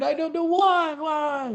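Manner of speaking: high-pitched feigned bafflement; mock puzzlement. Host sarcastically wondering why the investigation disappeared, mock puzzlement.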